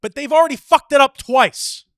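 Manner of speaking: high, very loud outburst; exasperated shout. Real, not acted. Arguing with a friend about the botched orders, exasperated shout. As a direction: shout the point home, incredulous.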